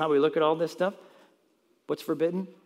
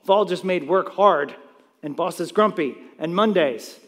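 normal talking voice

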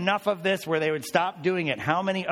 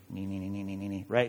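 low murmuring nee nee sounds, much softer; mock muttering; 8 semitones lower, 6 dB quieter, faster than usual. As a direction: imitate a crowd murmuring under its breath. Mimicking crowd muttering.

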